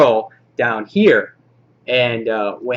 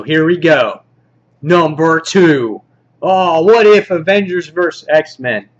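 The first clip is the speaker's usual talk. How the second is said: countdown announcer; 4 semitones higher, 6 dB louder than usual. Pretend. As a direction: announce the next entry with fanfare.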